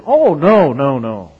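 mock shocked denial. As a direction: brush it off with fake shock.

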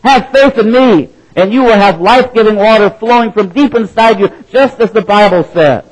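loud and high, crying out to the crowd; shouted proclamation. Acted. Jesus shouting to the festival crowd, loud and high. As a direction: shout it out like a raven finding food. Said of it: "Jesus stood up and shouted".

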